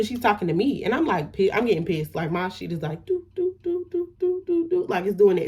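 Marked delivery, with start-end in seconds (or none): 3.1-4.9 high sung "do, do, do" like tension building; rising anger hum. Retold or imitated. Telling a friend how her anger built, a high rising hum. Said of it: "It's building".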